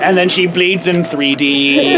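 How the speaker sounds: low, drawn-out slowed-film voice; ultra slow motion. A narrator's reading. Storyteller over Brutus bleeding, slow-motion voice. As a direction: drop low and stretch the last word. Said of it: "in ultra slow motion".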